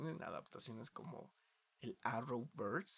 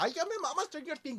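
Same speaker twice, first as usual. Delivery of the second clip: mocking fangirl; 13 semitones higher, 5 dB louder than usual. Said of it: "salió la segunda temporada".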